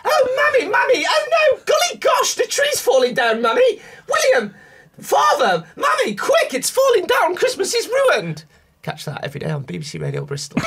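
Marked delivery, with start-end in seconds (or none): 0.0-8.4 put-on shrieking very high and loud, a panicked posh child; hammed-up posh boy. Doing James when the tree falls, a shrieking posh boy. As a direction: wail it as if the world has ended. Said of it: "He's a bit".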